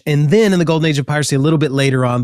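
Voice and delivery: usual speaking voice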